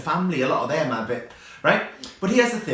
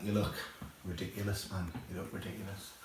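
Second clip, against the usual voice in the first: muttered aside; 8 semitones lower, 15 dB quieter than usual. Real, not acted. Mocking Gavin's look.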